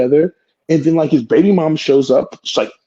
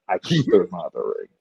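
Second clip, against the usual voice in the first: normal talking voice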